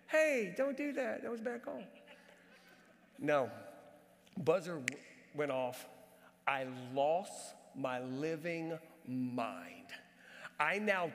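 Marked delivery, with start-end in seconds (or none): 0.1-1.8 mock politeness. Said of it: "I politely said". 6.5-9.7 very slow, low-pitched confession; dramatic slow emphasis. Real, not acted. Dramatic climax of his story.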